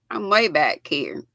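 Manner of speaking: normal talking voice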